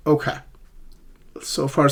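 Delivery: ordinary talk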